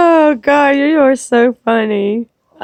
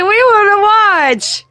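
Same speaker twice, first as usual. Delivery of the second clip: laughing quote; 7 semitones higher than usual. Retold or imitated. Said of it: "He's like".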